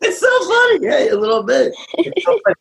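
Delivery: high laughing voice. Real, not acted. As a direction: laugh through it, delighted. Dad laughs with his son.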